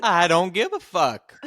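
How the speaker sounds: slow, hammed-up defiant refusal; mock defiance. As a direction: drag out "fuck" like a defiant witness. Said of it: "it was over and over".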